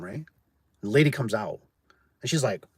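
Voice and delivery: ordinary talk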